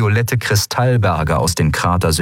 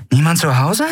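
higher, rising question; questioning call; 8 semitones higher than usual. A narrator's reading. Pirate to a silent empty ship, questioning call. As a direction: call out to see who is in.

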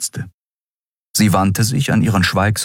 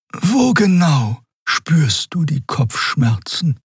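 whisper; 12 semitones higher, slower than usual. A narrator's reading. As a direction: whisper it seriously. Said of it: "Xia wurde ernst".

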